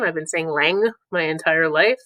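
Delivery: ordinary delivery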